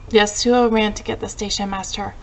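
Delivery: ordinary delivery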